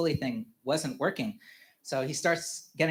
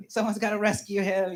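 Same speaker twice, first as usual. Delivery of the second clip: higher-pitched mock alarm; worried wife; 7 semitones higher, 3 dB louder than usual. Wife urges rescue of stuck son, mock alarm. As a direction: imitate a wife urging a rescue.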